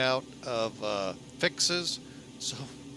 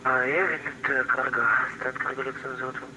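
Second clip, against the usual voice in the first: pilot over the radio; 5 dB louder, faster than usual. Real, not acted. Pilot reads back on radio.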